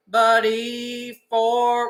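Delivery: rising sung phrase; rising pitch. Shown as a demonstration. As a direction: sing it climbing in pitch. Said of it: "we're coming up in pitch".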